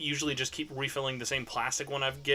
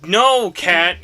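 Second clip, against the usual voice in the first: loud scolding; 5 semitones higher, 12 dB louder than usual. Real, not acted. Scolding Kat for unmuting.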